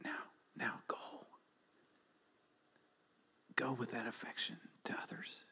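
whispered voice of God; a soft, whispered divine instruction. Retold or imitated. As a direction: whisper God's command gently. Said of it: "He said".

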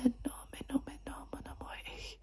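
soft breath-only French; whispered French. Shown as a demonstration. Doctor testing patient's hearing, whispered French. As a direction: whisper French close to the ear. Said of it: "whisper some languages in your ear".

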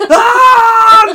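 very high, loud drawn-out scream; long hammed-up scream, as if burned; mock bellowing scream. Podcaster imitating coworker screaming in conference room, mock bellow. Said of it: "just screams".

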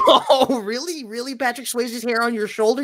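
high, mocking disbelief; mock surprise. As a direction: feign shock to needle him.

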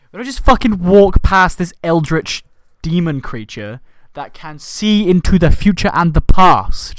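incredulous rant. Real, not acted. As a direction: demand an explanation, baffled. Incredulous protest.

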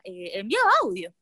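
normal talking voice

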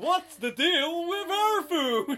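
very high, loud comic whine; hammed-up observational comedian as Santa; slow, stretched American stand-up delivery; Jerry Seinfeld. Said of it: "I didn't know it was Seinfeld".

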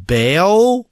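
"Bail" as a high, loud, drawn-out question; "Bail" swooping up, unsure; child guessing. Retold or imitated. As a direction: guess "Bail" like a hopeful new reader. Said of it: "she's like".

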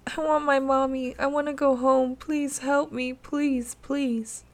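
put-on high, tearful begging little girl; pleading child. A narrator's reading. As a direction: beg for help through tears. Terrified child begging between sobs.

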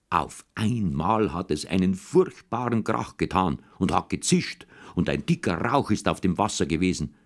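usual speaking voice

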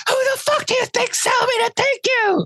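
very high falsetto outburst; hammed-up high-pitched protest; squealed mock outrage. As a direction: squeal it like a panicked, indignant man. Acted outburst from the film.

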